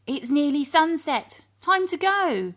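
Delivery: high, loud hurried call; fussing grandfather calling out; urgent Grandpa. Acted. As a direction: rush them along in alarm.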